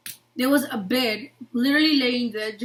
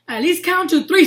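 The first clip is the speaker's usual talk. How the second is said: higher, loud retold complaint; pained protest; 5 semitones higher, 8 dB louder than usual. Child to medic who popped the wrist, indignant. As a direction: burst out in mock outrage.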